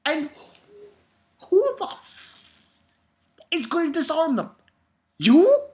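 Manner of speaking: high, loud incredulous yell; disbelieving rant. Real, not acted. Reader yelling at the character, outraged. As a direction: scream the question at the character. Ranting at the manga.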